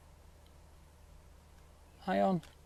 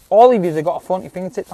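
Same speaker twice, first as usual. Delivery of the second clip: mock telling-off; 8 dB louder, faster than usual. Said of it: "I'm fucking telling you off now".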